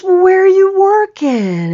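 bright excited phone voice; eager question. Retold or imitated. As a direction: ask like you're thrilled to hear from her. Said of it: "He goes".

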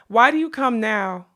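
loud, pained demand; anguished outburst. A narrator's reading. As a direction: cry it out in reproach. Molly's tearful reproach to unicorn.

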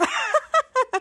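high, put-on peals of laughter; imitated mocking laugh. Miles laughs at Bose's solemn speech, mock laughter. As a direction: laugh high at someone's big moment. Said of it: "Miles begins to laugh".

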